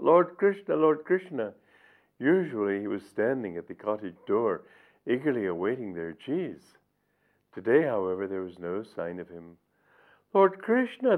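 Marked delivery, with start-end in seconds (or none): 0.0-1.5 high, loud calls of "Lord Krishna"; calling out. Acted. Gopis calling for Krishna at his cottage, loud high calls. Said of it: "crying out". 10.3-11.2 very high, loud worried call; anxious cry. Acted. Gopis calling anxiously for missing Krishna, very high cry. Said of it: "they cried".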